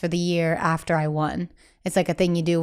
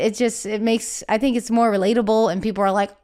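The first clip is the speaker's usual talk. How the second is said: usual speaking voice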